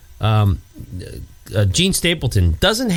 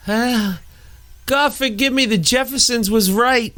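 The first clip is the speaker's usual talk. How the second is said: high, stunned sitcom plea; awestruck prayer; 11 semitones higher than usual. Acted. Archie looking up at his rescuer, awestruck. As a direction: act out humbled awe before God.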